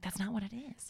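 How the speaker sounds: whisper. Retold or imitated. Retold inner thought.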